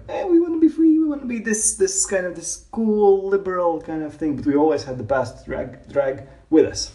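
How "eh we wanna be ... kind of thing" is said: mock free-spirit voice. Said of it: "but we were always like".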